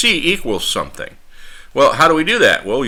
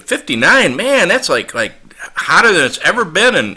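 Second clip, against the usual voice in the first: astonished disbelief; 7 semitones higher, 3 dB louder than usual. Retold or imitated. Phone mix-up over temperature.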